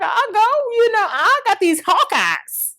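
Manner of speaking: mock-proud auntie boasting she will watch; aunt's promise. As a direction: brag about your hawk eyes to your niece. Aunt voiced promising to watch.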